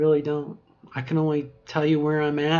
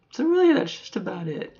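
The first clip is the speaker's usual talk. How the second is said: bright wrap-up; 9 semitones higher than usual. Real, not acted. Upbeat wrap-up.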